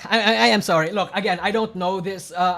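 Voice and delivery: normal talking voice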